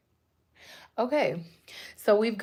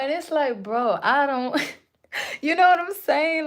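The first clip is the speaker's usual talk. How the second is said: laughing; 7 semitones higher, 5 dB louder than usual. Real, not acted. Laughing at her new accent.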